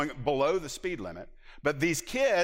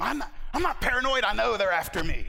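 high, loud mock-paranoid insistence; mock paranoid protest; 8 semitones higher, 5 dB louder than usual. Preacher quoting his old self, mock paranoid and loud. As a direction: play up the paranoia for laughs. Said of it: "I used to say all the time".

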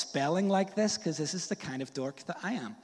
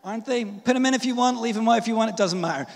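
high, loud, insistent; loud comic tirade; comic rant; 8 semitones higher, 10 dB louder, much faster than usual. Real, not acted. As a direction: raise the voice in mock exasperation. Mock rant about optional consonants.

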